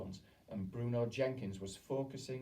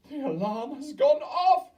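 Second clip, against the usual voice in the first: high, loud, slow witch shriek; 15 dB louder than usual. A narrator's reading. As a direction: shriek in triumph.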